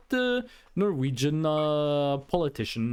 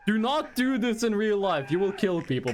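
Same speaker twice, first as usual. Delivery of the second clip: ordinary delivery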